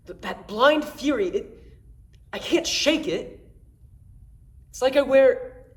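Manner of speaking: tense confession of rage, halting then forceful; agitated, angry Jason. Acted. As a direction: let the frustration break out in emphatic bursts. Jason's monologue turns to fury.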